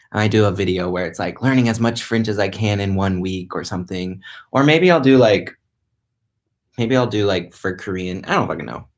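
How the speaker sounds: ordinary delivery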